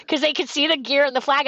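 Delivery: ordinary delivery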